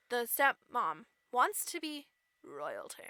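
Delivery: ordinary talk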